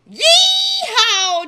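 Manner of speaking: whooping holler, high and loud. Acted. Jackrabbit hollered.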